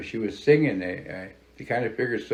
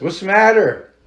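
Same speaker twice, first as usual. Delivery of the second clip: high, louder concerned call; 10 semitones higher, 8 dB louder than usual. Retold or imitated. He calls to the crying woman on the road, high loud call. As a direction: call out to a stranger in the dark.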